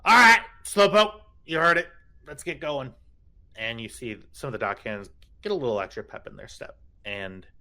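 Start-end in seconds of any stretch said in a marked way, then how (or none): 0.0-2.9 high, loud call to the crew. Acted. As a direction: shout orders across the dock. GM voices NPC calling crew.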